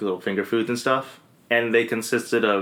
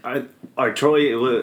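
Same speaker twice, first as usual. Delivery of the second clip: dramatic trailer voice; much slower than usual. Pretend. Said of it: "really dramatic voice?".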